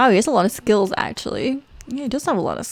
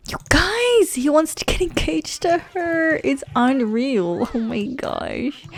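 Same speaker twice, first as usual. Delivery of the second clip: much higher, excited news to viewers; thrilled; 6 semitones higher, slower than usual. Real, not acted. Telling her viewers the sim wants to propose, higher and excited. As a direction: burst out with the big news.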